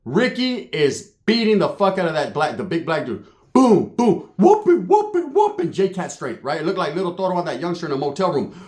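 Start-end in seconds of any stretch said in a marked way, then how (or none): 3.5-5.7 high, excited mimicked blows; punch sound effects. Storyteller shows Ricky beating the big dude, excited blow noises. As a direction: call out each hit, pitch jumping up.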